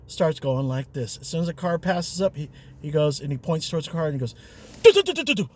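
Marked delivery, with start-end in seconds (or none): 4.8-5.5 put-on drunk car noises. Drunk pointing at passing cars.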